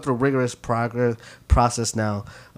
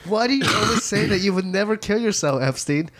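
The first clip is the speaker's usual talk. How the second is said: high-pitched mock bewilderment; acted baffled questioning; 8 semitones higher than usual. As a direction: question a friend in a put-on high voice. Voicing a suspicious friend of Epstein.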